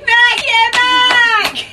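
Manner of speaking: loud, high hype man shout. Retold or imitated. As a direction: hype up the crowd like an announcer. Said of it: "there's a hype man".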